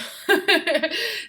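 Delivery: sudden high burst of laughter. Real, not acted. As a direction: laugh out, surprised and amused. Baby chewing her arm.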